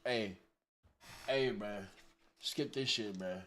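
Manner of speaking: usual speaking voice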